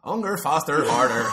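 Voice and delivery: put-on loud chanted Newry accent; robotic band lyrics in mock Newry accent. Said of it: "Daft Punk if they were from Newry".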